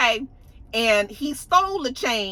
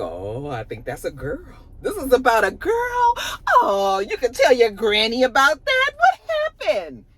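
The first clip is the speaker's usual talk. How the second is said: cooing granny voice. Retold or imitated. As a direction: coax it out of her grandson like a sweet old granny. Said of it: "And then she was just like".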